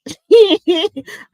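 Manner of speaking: a sudden loud burst of laughter. Real, not acted. Laughing out loud at the chat during a live stream.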